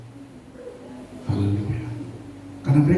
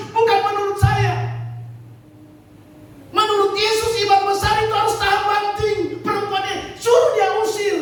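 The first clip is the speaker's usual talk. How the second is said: full-force preaching. Acted. Pastor preaching to the congregation, full-force preaching. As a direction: hammer the point home to the flock.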